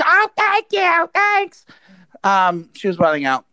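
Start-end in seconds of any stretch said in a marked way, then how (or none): 0.0-1.6 very high, slow thankful squeak; high grateful voice. Retold or imitated. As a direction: stretch out a high-pitched thanks.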